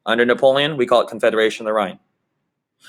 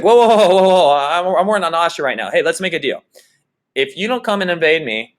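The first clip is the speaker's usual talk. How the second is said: higher, louder mock alarm, hurried; alarmed Bismarck; 6 semitones higher, 3 dB louder than usual. Bismarck hastily pleading with Napoleon, hammed-up alarm. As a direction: hold him off in a comic panic. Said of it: "And Bismarck's like".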